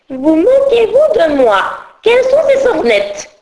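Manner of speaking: high, loud furious scolding; outraged teacher roaring at Absinthe, high and loud. Acted. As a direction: roar at the insolent pupil. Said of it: "Rugit la maîtresse".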